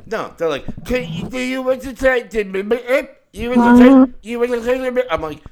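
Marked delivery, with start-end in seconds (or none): 0.9-5.0 much higher, loud, garbled intercom voice; garbled drive-thru worker. Retold or imitated. Mocking the drive-thru worker pushing the app, garbled and high. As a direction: imitate an unintelligible drive-thru speaker, loudly. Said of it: "you never understand what they're saying".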